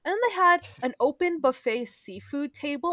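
ordinary delivery

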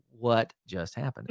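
slow, hushed disbelief; quiet, drawn-out baffled aside; stunned bewilderment. Retold or imitated. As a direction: retell it softly, as if dumbfounded. Retold bewilderment after the angry greeter.

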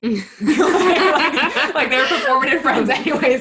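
high, loud, through real laughter; laughing. Real, not acted. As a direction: burst out laughing as you say it. Laughing over her friendships.

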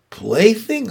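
high, scoffing repeat of Plaything; scoffing echo. A narrator's reading. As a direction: toss back Plaything with disdain. Charlotte echoes, dismissive.

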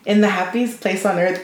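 ordinary delivery

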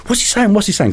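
high, pressing nervous repeat; anxious question. Retold or imitated. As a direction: fret at him, needing to know now. Worried mates asking in panic.

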